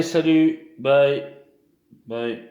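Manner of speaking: normal talking voice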